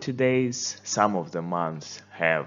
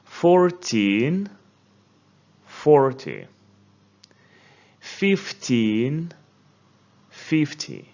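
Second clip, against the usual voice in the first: fourTEEN rising, FORty falling; stress contrast; 4 semitones higher, slower than usual. Shown as a demonstration. Teacher drills number stress with class. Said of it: "this is true for all other numbers".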